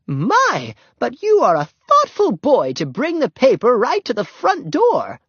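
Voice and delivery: high, sweet lady's voice full of praise; gushing old lady. A narrator's reading. Missus Peabody praising the paper boy, gushing. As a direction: gush over the thoughtful boy.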